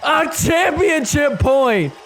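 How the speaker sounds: high, slow announcer shout; excited call. Real, not acted. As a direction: stretch out the big moment. Championship point call.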